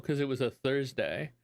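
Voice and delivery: ordinary talk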